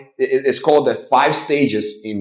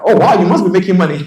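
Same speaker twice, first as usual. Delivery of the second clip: impressed millionaire; 7 semitones higher, 6 dB louder, faster than usual. Retold or imitated.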